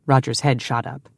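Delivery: ordinary delivery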